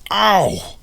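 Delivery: loud, high "ow"; yelp of pain. A narrator's reading. Michael kicked in the shin, yelping. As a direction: yelp from a kick. Sam kicks him in the shin.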